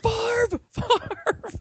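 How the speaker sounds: very high, drawn-out mock cry; mock yelling. Fan still celebrating despite the neighbors, high mock yell. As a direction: call the hero's name in a high put-on yell. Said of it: "we're all yelling".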